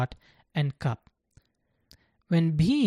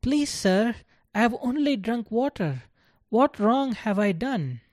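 put-on higher, begging child's voice; pleading; 6 semitones higher than usual. A narrator's reading. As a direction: beg for mercy, bewildered. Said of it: "Bhim pleaded".

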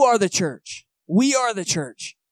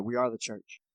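imitating his buddy; 7 semitones lower, 11 dB quieter than usual. Buddy correcting friends who say going to church, low and soft. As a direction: lower and softer, a mock correction of a friend. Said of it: "He's like".

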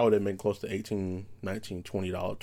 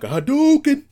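high, loud, stretched game-move shout; mad battle cry; 9 dB louder than usual. Retold or imitated. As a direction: yell it like launching an attack. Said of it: "I was just mad enough".

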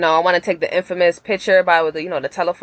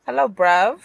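tentative go at a London accent, slightly higher; 5 semitones higher, slower than usual. Shown as a demonstration. Asked by the host to show it, attempted London accent. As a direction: try out a Top Boy London accent. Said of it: "the Top Boy accent. Let me hear it".

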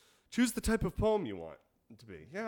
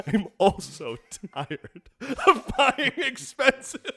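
laughing rant; 10 semitones higher, 7 dB louder than usual. Real, not acted. Laughing rant, loud and high.

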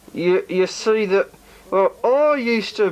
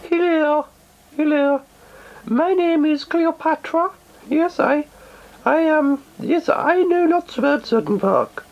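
high, coy character voice; Cleopatra voice; 7 semitones higher than usual. Acted. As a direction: tease them in a high voice. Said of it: "that's [name] and Cleo".

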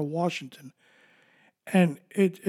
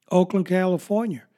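usual speaking voice

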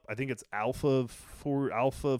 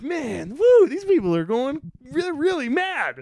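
gleeful troll; 11 semitones higher, 8 dB louder than usual. Retold or imitated. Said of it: "I'm like".

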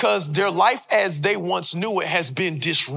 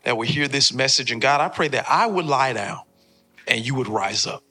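opening prayer; 5 semitones lower than usual. Acted. Pastor leads opening prayer.

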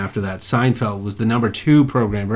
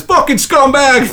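slow, higher sneering jeer; heckler's insult; 13 semitones higher, 6 dB louder, slower than usual. Retold or imitated. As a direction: spit it out slowly like a heckler. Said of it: "someone still says".

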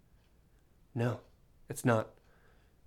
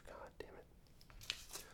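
whispered curse; 24 dB quieter, much slower than usual. Real, not acted.

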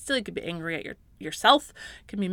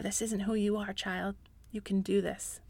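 put-on much softer, tender reassurance to a child; God's gentle voice; 10 dB quieter than usual. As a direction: speak softly like a loving father. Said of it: "remind you of who you really are".

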